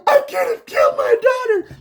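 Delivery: high, loud wailing sob-shout, hammed up. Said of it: "the one where he goes".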